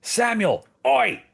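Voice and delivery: high, loud call across the room; sharp hailing call. Acted. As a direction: shout his name to get attention. Player calls out to NPC.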